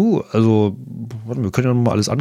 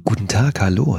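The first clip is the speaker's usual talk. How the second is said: ordinary talk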